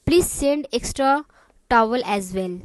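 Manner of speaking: slow, even reading; slow and clear. Shown as a demonstration. Teacher reading the receptionist's answer slowly. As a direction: read it slowly for beginners. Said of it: "मैं इतना आराम आराम से इसलिए बोल रही हूँ".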